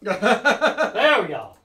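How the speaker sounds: laughing through the words; drawn-out laughing relief. Real, not acted. Laughing while finding post.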